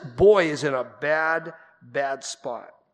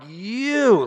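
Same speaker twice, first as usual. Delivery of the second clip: "You," lifted high and louder, full of feeling; emotional stress on you; 7 semitones higher, 4 dB louder than usual. Shown as a demonstration. Preacher shows the congregation Jesus' emotional "You,". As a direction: lean hard into "You," as a deep emotional cry. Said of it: "This, this word is a deep emotional".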